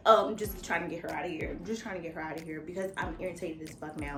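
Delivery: inner thought. Retold or imitated. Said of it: "my mode of my mind was like".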